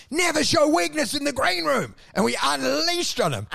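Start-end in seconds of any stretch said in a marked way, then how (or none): 0.1-1.9 high-pitched mock yelling; retold yell. Comic scolding another act backstage, mock yelling. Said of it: "like, yelling".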